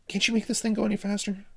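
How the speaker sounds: high, fast urgent demand; impatient. Acted.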